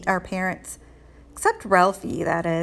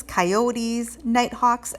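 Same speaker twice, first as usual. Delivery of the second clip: ordinary delivery, nothing marked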